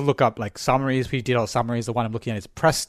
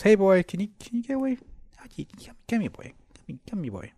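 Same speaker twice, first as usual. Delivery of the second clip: soft coaxing call to the dog; 6 semitones higher, 5 dB quieter than usual. Real, not acted. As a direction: softly coax the puppy over. Calling puppy off cables.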